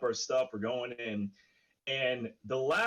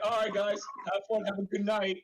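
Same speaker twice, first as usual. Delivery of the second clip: higher, friendly neighbour saying goodnight; overly polite neighbour; 5 semitones higher than usual. Retold or imitated. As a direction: play the suddenly nice neighbour. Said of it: "He was like".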